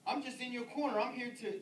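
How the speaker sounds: usual speaking voice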